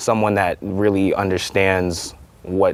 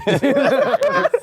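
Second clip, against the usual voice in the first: high laugh. Real, not acted. Laughing at own story.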